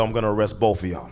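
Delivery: ordinary talk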